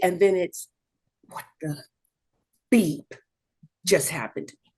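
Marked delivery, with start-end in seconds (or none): 1.3-4.7 whispered disbelief to herself; stunned whisper. Retold or imitated. Patient after the diagnosis, whispered shock. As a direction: breathe it in shock.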